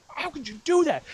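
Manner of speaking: high-pitched acted exasperation; outraged sibling's squeal; really mad. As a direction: demand it in high, incredulous anger. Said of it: "I was getting really mad".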